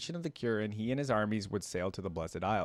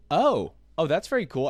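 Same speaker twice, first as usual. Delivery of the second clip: sudden delighted oh, higher and louder; surprised; 6 semitones higher, 7 dB louder than usual. Real, not acted. Reacting to Pentakill trivia, delighted surprise. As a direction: genuinely caught off guard by a fun fact.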